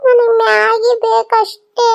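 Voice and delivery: put-on high, slow spoilt-child whine; girl whining to her mother for maggi; whiny child. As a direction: drag it out like a sulking little girl. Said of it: "Maggi ಬೇಕು ಅಂತ ಅಳ್ತಾ ಇದ್ದಾಳೆ".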